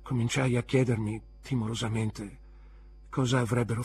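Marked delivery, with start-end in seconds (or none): none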